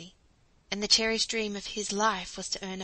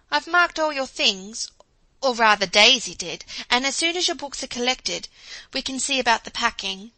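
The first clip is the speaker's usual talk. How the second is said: a hint of a busy motherly woman, higher and louder; lightly voiced brisk mother; 4 semitones higher, 8 dB louder than usual. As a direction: bustle through the packing plans. Jo busily plans the packing.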